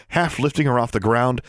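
ordinary delivery

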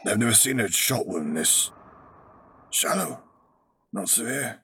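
strained pained rasp; groaning in pain. A narrator's reading. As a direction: push the words through pain. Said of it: "he groaned".

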